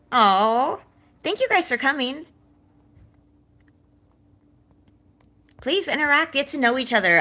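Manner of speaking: high, loud, bubbly thanks; eager host calling the room to mingle; excited welcome. Real, not acted. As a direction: gush over the guests as they arrive. Welcoming guests at her party.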